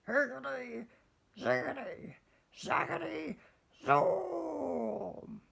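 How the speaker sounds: high, chanted magic words; witch casting a spell. Acted. Witch conjuring a new broom, high chanted spell. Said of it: "she muttered a spell".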